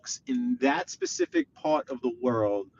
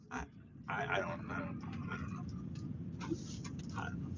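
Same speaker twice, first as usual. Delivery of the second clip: softer, half-voiceless mutter; muttered under breath; 8 semitones lower, 18 dB quieter, faster than usual. Real, not acted. Mumbling to himself while stuck.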